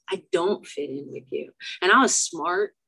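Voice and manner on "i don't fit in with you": softer, sadder repeat. Real, not acted. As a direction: lower and quieter, hurt. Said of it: "So I don't fit in with you".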